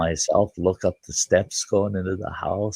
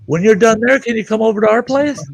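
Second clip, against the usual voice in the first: high raised call; 6 dB louder than usual. Retold or imitated. Neighbor across the street calling to Santa, raised high. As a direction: shout the request across. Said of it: "And they go".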